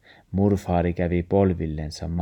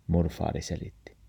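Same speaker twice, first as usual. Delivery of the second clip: ordinary delivery, nothing marked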